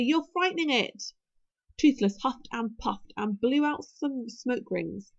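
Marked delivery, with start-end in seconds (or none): 0.0-1.0 higher, exasperated reproach; impatient. A narrator's reading. Hiccup warning Fishlegs off the frightened dragon, impatient. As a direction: reprove him impatiently, pitch lifted. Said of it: "said Hiccup impatiently".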